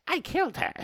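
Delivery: high squeaky creature voice. Acted. Creature confessing to the elf, squeaky high.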